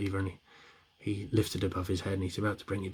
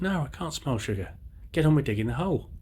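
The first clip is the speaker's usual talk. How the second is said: raised, impatient daddy mole; higher, insistent parent's reply; 6 semitones higher than usual. Acted. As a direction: brush the child off, pitch up. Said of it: "Daddy mole says".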